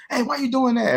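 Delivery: high-pitched mock yell, no real volume; retold yell. Dad retelling how he snapped at his boys, mock yell. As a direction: call it out high, imitating a parent's outburst. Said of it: "And I yell".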